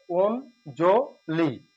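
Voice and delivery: অঞ্জলি drawn out slowly as "ond-jo-li"; correct pronunciation. Shown as a demonstration. Teacher repeats the correct form for the class, slow. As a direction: stretch অঞ্জলি slowly, each syllable clear. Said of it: "সঠিক উচ্চারণ".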